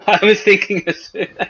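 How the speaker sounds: laughing loudly through the line; cracking up while saying it. Real, not acted. Joking about the name Asus.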